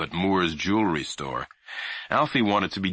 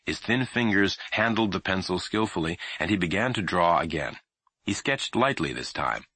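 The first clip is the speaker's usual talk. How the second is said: ordinary delivery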